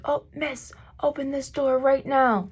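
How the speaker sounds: stern doctor. Retold or imitated. Doctor demanding she open door.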